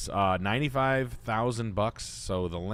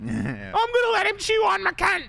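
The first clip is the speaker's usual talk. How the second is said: much higher, louder gushing falsetto of an American woman swooning over an Aussie; 7 dB louder than usual. Retold or imitated. Mocking American women drooling over an Australian nurse, high gushing falsetto. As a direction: squeal it like a starstruck American girl, Australian accent and all.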